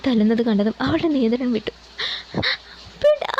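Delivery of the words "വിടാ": sudden high piercing scream; screamed cry. A narrator's reading. Meenu screams as they beat her fiancé, piercing scream. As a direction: shriek it out in panic. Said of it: "അവൾ അലറി വിളിച്ചു".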